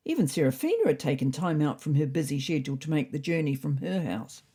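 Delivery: plain reading voice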